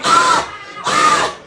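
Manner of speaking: squeaky terrified cry; a hammed-up comic scream; high panicked shriek. Panic scream before jumping.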